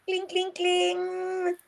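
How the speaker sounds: "cling, cling, cling" high, slow and drawn out; dramatized sound. Shown as a demonstration. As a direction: sing "cling" slowly on a high pitch. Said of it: "you've got to make sure you dramatize".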